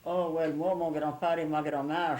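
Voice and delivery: normal talking voice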